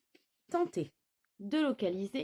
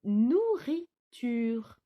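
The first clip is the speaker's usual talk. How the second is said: "nourriture" said very slowly, syllables stretched; drawn-out syllables; 4 dB louder, much slower than usual. Shown as a demonstration. Teacher reading a longer word to children, very slowly. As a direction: spell out "nourriture" slowly in sound. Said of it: "un petit peu plus long cette fois-ci".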